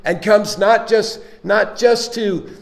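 loud, driving preacher delivery; preaching. Acted. Preacher urging the congregation that Christ lives within, loud. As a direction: build it up and press it home to the church.

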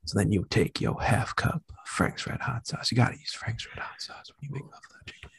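breathy seductive whisper; sultry whisper. Acted.

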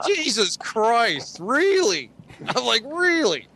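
high, drawn-out incredulity; retold exasperated disbelief. Man retells reacting to date's ex talk, exasperated. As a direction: react as if you can't believe it, pitch climbing.

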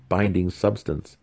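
usual speaking voice

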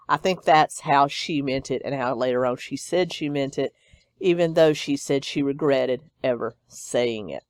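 normal talking voice

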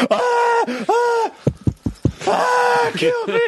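panicked bird voice screaming to be killed; mock bird shriek. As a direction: shriek like a bird caught in a cat's mouth. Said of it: "it'd just be like".

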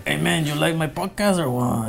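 put-on playful Mexican accent. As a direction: Mexican accent, teasing buddy. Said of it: "that Mexican accent we like to do".

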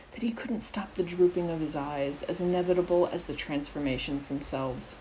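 ordinary delivery, nothing marked

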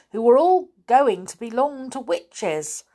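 plain reading voice

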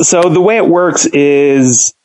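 ordinary talk, nothing marked

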